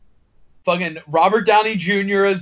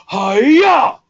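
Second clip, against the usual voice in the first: game yell; 6 semitones higher, 6 dB louder than usual. Retold or imitated. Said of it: "they randomly go".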